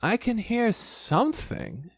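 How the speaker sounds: lightly voiced child, high and slow; Christopher Robin. A narrator's reading. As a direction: say it slowly, pitched high, listening. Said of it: "said Christopher Robin".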